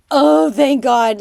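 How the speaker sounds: loud, drawn-out cry of relief; reliving an elated outburst; huge relief. Retold or imitated. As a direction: exclaim it slowly, flooded with relief. Retold relief at election win.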